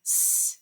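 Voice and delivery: S as a soft unvoiced hiss; just hiss the S. Shown as a demonstration.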